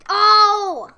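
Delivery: loud shocked yelp; startled shout. Real, not acted. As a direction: yell in sudden surprise. Killed by flying apple.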